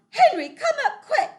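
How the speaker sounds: very high, loud urgent call; mother yelling for her husband. Acted. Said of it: "And she yells".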